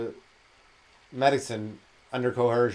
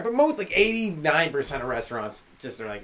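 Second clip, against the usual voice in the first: usual speaking voice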